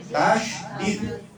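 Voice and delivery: Tasydid in two slow parts, tasy-did; correct pronunciation. Shown as a demonstration. Teacher corrects the word tasjid, careful pronunciation. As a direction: say Tasydid slowly with a clear sh. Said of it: "Bukan tasjid ya".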